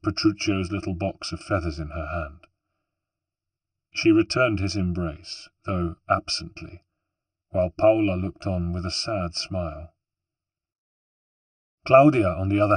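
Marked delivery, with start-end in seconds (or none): none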